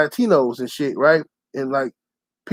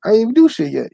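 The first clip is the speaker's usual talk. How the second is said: retold indignation; 6 semitones higher than usual. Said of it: "I said".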